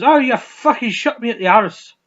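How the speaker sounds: high, loud, outraged mock South African; bad South African accent. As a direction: yell indignantly in a botched South African accent. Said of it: "I can't do a fucking South African".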